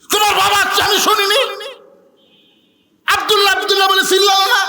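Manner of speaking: wife shouting. Acted. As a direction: snap back at the husband at full volume. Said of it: "এত জোরে কয়ও না".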